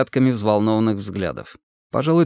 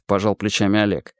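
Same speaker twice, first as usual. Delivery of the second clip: ordinary delivery, nothing marked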